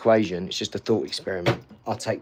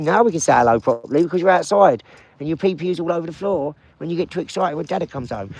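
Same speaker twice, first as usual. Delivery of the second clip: higher, louder, fast pet talk; talking to dog; 5 semitones higher, 6 dB louder, faster than usual. Real, not acted. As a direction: chatter to the dog, excited. Greeting dogs at home.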